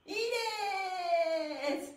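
very slow, high, loud pose shout; drawn-out shout. Acted. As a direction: belt it out and hold it. (ポーズの掛け声を長く叫ぶ)

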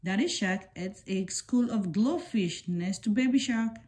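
usual speaking voice

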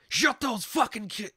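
high, loud hammed-up snap; mock angry yell.

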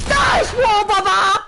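loud high shout. Acted. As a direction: yell it out. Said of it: "I said".

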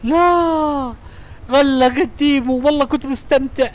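صرخة خيبة عالية حين تُغلق اللعبة فجأة. Real, not acted. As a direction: cry out as the game cuts off.

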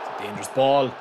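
normal talking voice

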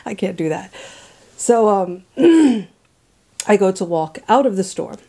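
usual speaking voice

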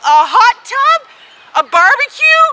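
high, thrilled listing that climbs into a woo; real, so excited. Real, not acted. Winner telling what she won, wildly excited. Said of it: "I am so excited".

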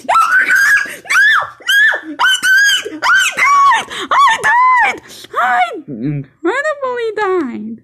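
high, loud mock screams of dying; pretend panicked scream. Kid shows viewers how he would scream if he died. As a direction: ham up a shrieking game death. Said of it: "then I'll be like".

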